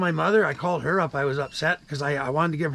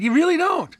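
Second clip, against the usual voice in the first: much higher and louder at the peak; retold angry outburst; 10 semitones higher, 4 dB louder than usual. Caller telling off the agent, high and heated. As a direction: fling the accusation, boiling over.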